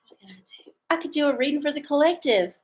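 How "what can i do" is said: barely audible unvoiced whisper, fading away; fainter whisper. Real, not acted. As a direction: let it almost vanish. Repeats question, even fainter whisper.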